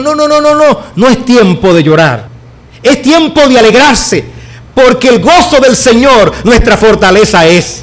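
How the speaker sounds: high, loud joyful proclamation; Ezra and Nehemiah proclaiming. Retold or imitated. Ezra and Nehemiah telling the weeping people to rejoice, loud cry. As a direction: call out to the weeping crowd to rejoice. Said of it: "Esdras y Nehemías le dijeron".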